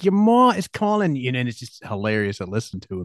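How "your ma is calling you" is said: put-on high, loud Boston accent call. Bandmate playing around with his accent, mock Boston accent. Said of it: "he'll do the Boston accent".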